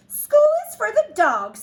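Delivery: high, loud cat mama laying down the law; emphatic. A narrator's reading. Mama cat tells her kitten no school, emphatic. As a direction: declare it loudly and high-pitched. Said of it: "stated Mama Junebug Jones em-, empathetically".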